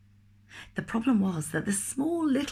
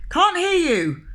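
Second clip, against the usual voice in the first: loud, high thundered shout; thundering; 12 semitones higher, 7 dB louder, slower than usual. Acted. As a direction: thunder it at him. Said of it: "thundered the grocer".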